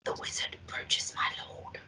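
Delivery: soft whispered announcement; hushed whisper. Acted. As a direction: whisper it like a sly servant. Quoting the film.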